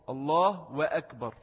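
slow الله وأكبر with an audible wa; إضافة واو. Shown as a demonstration. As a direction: insert a clear W syllable before akbar in وأكبر. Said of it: "بيقول واو". («وأكبر» بواو ظاهرة بعد «الله»)

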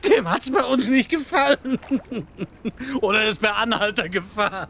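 high, amused, laughing voice; laughing joke. Acted. Burma jokes to Bellita about the lost body, laughing. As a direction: joke while still laughing.

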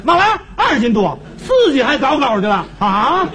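high-pitched comic disbelief; hammed-up offended protest; indignant outburst. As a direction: blurt it out, scandalised. Husband indignant at low weight guess.

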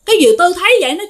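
ordinary delivery, nothing marked

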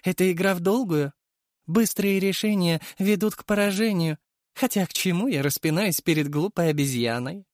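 high, mocking creature voice; sneering worm king voice. A narrator's reading. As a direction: voice an amused parasite villain. Villain continues his speech.

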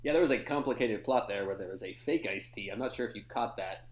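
low, booming show host; host the morning show, deep and loud; radio host voice. Acted.

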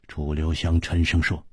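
usual speaking voice

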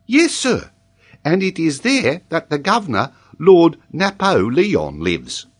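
high-pitched schoolboy rattling off his lesson; earnest young pupil, high-voiced; child pupil reciting.

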